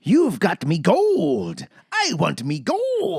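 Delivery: high, slow, cranked-up fake Irish accent; slow, hammed-up Irish leprechaun voice; hokey Irish leprechaun. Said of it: "crank it to ten".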